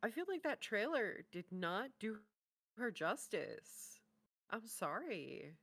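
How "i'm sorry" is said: normal talking voice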